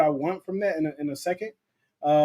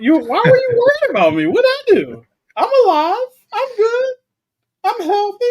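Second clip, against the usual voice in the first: much higher squeaky voice, playfully defensive; mock-offended falsetto; 8 dB louder than usual. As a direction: answer in a high, innocent voice. Mock-defensive reply to chat comment.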